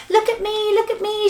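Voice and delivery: high, thrilled calling out; excited singsong shout from above; gleeful cry. Acted. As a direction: show off with delight. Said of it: "she cried".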